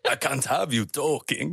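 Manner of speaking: hammed-up vampire accent; mock Transylvanian accent; Dracula. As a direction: play it as a vampire, half laughing. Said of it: "He's Dracula now".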